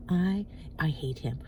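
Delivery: slow, secretive whisper; conspiratorial whisper. Retold or imitated. Stranger confides about the mayor.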